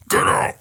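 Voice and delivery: short, guttural belch; burp. Real, not acted. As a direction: burp bursting out mid-talk. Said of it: "fucking Randall Flagg burp".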